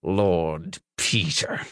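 slow, low words through clenched teeth. A narrator's reading. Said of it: "forced out between clenched teeth".

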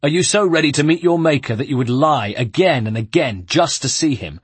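loud, menacing question; threatening guard. Acted. The guard threatens the monk face to face, loud. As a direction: hiss the threat in his face.

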